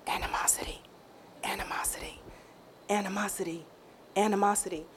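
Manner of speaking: soft whispered word, fading back to a hush; sweet whisper. Retold or imitated. The Holy Spirit gently telling her, soft whispered repeats. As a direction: breathe it gently, like a secret. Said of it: "And he was real sweet about it".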